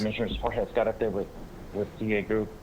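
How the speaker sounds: soft, hesitant self-introduction; too quiet to hear. Real, not acted. Applicant introducing himself to the commissioners, barely audible. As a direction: give your name quietly, under normal volume. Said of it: "I can't hear you".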